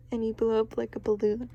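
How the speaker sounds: usual speaking voice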